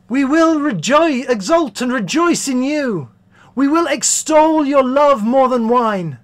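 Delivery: high, loud jubilant declamation; exultant chorus. Acted. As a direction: proclaim it like a rejoicing chorus.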